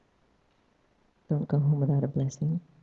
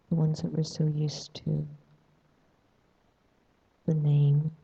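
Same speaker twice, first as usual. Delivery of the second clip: guided meditation. Acted. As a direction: ease them into stillness.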